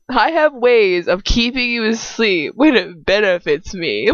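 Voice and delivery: loud, slow, hammed-up creep; mocking imitation. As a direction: draw the line out to ridicule him. Mockingly repeats the creepy line.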